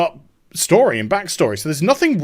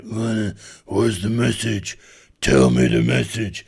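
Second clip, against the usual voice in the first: much lower, weak croaky voice; mock groggy captive; 8 semitones lower than usual. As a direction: act out a dazed prisoner, very low. Acts the knocked-down captive answering.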